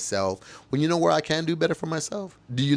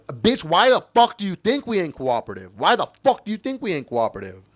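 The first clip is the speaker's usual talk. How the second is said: loud, higher outburst; indignant, raised-voice challenge; angry retort; 4 semitones higher, 4 dB louder than usual. Real, not acted. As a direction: throw the question back angrily. Angry retort to police claims.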